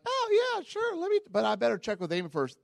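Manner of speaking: high, sing-song fake enthusiasm; fake eager brush-off. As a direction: sound keen while stalling. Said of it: "and I'm like".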